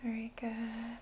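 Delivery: hushed encouraging whisper. Real, not acted. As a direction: whisper the praise without waking the calm. Whispered praise to breathing client.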